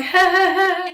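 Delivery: high, awkward "ha, ha, ha"; forced laugh. Retold or imitated. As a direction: fake a high-pitched laugh to cover embarrassment. Covering embarrassment before the DJ.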